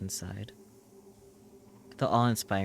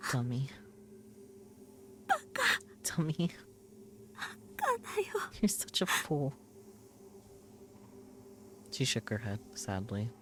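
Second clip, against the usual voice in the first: put-on tearful scolding girl; 10 semitones higher than usual. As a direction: scold him through tears. Rimi scolds and grieves over hero.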